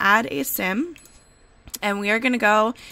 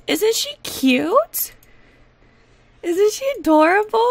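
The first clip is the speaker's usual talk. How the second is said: adoring coo; 9 semitones higher than usual. Real, not acted. Gamer showing off her new toddler, adoring coo. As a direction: coo over her, stretching the words upward. Cooing over finished toddler.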